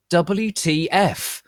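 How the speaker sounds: loud high slow W T F; slow drawn-out shout, letter by letter. Acted. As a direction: spell it out in outrage. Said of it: "I shouted".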